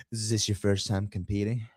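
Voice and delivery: low and soft, an oily imitation; Mike's creepy voice. Mocking Mike chatting up the Texas athlete, low sleazy murmur. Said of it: "this is the exact voice Mike's using".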